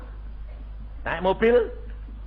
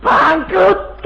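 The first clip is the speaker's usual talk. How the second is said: punchline laugh; 14 semitones higher, 4 dB louder than usual. Real, not acted.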